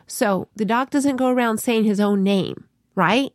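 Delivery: ordinary delivery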